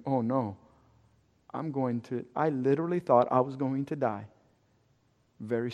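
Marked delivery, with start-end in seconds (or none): 0.1-2.2 much softer, trailing into dread; scared inner voice. Retold or imitated. Patient thinking he will die, a hushed scared voice. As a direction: barely voice the fear. Fearing death at the exam.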